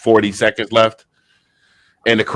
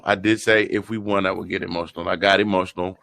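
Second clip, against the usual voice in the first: softly emotional; 4 semitones lower, 7 dB quieter than usual. Real, not acted. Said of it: "but I did get emotional".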